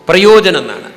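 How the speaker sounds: പ്രയോജനം punched loud and hard; stress on usefulness. Real, not acted. Speaker to audience, driving the point home, loud stress on പ്രയോജനം. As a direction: hit പ്രയോജനം with a loud jab. Preacher hammers key word.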